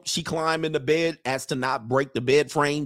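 normal talking voice

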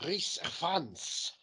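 Rhys Ifans said slowly in Welsh, Rhys rolled out and stressed; Welsh pronunciation. Shown as a demonstration. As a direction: give Rhys Ifans a slow Welsh sound. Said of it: "Gotta say it in Welsh".

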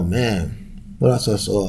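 normal talking voice

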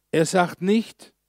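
nicht stretched out with a rising tune; slow down and lift on nicht; slow emphatic nicht. Shown as a demonstration. Preacher setting up a contrast, slow and pointed. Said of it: "slowed contrast phrase".

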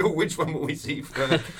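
high, loud mock excitement; gleeful eagerness. Imitating a traveller keen to meet bandits, high loud mock glee. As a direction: sound thrilled by the danger.

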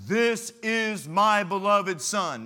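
slow, loud, high proclamation from heaven; booming voice of God. Retold or imitated. Preacher booms God's voice from heaven over the Son. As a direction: announce it like God speaking from the clouds. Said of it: "The voice comes from heaven".

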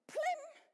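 high bright plim like a light clicking on; playful ping; magic switch-on sound. Shown as a demonstration. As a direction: make the sound of a light snapping on. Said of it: "da ist es".

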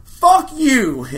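retold shout at George Lucas; furious yell. Comic acting out Patton yelling at Lucas, loud angry outburst. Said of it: "And he goes".

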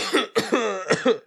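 harsh real coughing; coughing fit. Real, not acted. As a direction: cough hard after inhaling a bug. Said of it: "I inhaled a, s-, uh, like, a bug".

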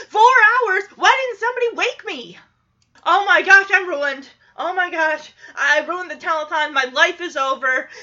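high, loud frantic wail; hammed-up meltdown on live air; panicking. Danny learns he slept four hours, loud high panic. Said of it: "Danny immediately starts panicking".

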